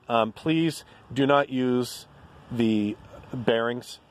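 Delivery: slow, with "not" leaned on hard; stress on NOT. Real, not acted. To viewers warning against the stock bearings, slow and stressing "not". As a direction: warn them slowly, leaning on "not".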